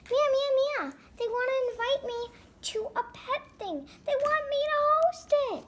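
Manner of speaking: high, loud, gushing announcement; excited news. Pretend. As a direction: burst out with thrilling news. Bursting to tell Mia big news.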